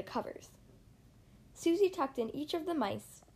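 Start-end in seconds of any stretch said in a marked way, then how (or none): none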